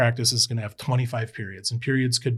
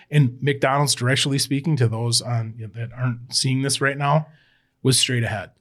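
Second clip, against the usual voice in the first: ordinary talk, nothing marked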